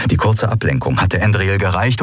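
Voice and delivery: usual speaking voice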